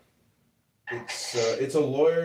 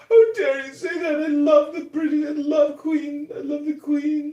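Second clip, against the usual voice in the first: much higher and louder, a mock-offended gush over the Queen; outraged royalist woman; 6 dB louder than usual. Friend scolds him for mocking the king and queen, mock-outraged high gush. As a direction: squeal it like a shocked royal fan. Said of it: "And she's like".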